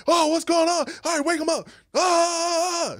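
high, loud frantic wake-up call; panicked disciples. Retold or imitated. Disciples shouting to wake Jesus in the storm, panicked. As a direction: shake him awake in a panic.

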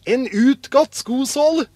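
high, loud, proud answer; excited announcement. A narrator's reading. Askeladden shows his brothers a worn shoe sole, excited. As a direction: present junk like a treasure.

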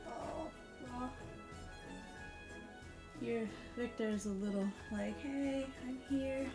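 normal talking voice

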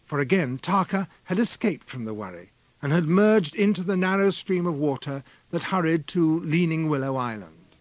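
plain reading voice

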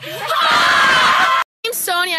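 high, slow, stretched singsong "My name is"; mock intro. Friend roasting himself in a trend video, high drawn-out intro. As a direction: drag out "My name is" like a mocking introduction.

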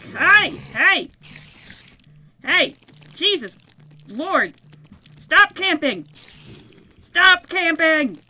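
loud, fed-up shouts at the mobs; angry yelling. Real, not acted. Gamer yelling at mobs camping his spawn, loud and fed up. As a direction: yell at campers to back off.

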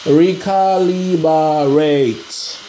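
Recalibrate drawn out very slowly, syllable by syllable; slow careful syllables. Shown as a demonstration. Hobbyist recalling the word for viewers, very slow. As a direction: take Recalibrate one syllable at a time. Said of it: "what's the word again".

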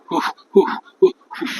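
breathy, voiceless puffs of discomfort; pained huffing. Acted. Feverish officer suffering alone, pained huffing. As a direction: huff "oof" repeatedly through the fever.

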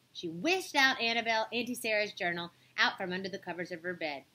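plain reading voice

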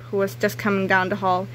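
ordinary delivery

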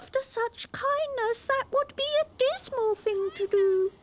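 very high squeaky oyster voices; little oysters crying out. A narrator's reading. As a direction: squeak it like tiny worried oysters. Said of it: "the oysters cried".

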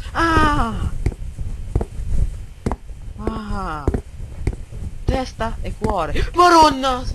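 high frightened cries; scared screams. Real, not acted. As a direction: cry out in fright. Scares while playing.

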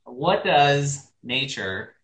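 ordinary delivery

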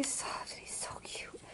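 unvoiced whisper, cooing over a plush toy; soft whisper over a cute toy. Real, not acted.

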